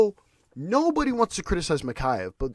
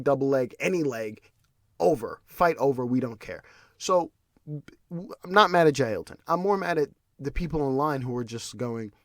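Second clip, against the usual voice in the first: usual speaking voice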